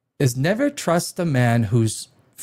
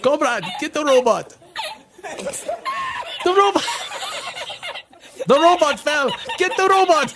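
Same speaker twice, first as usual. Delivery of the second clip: high-pitched frantic cries over laughter; mock panicked shouting. Comedian voicing panicked staff as the robot falls, mock shouting. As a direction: call out in hammed-up alarm, pitched way up.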